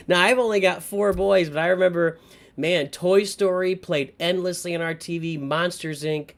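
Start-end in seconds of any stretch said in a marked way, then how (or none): none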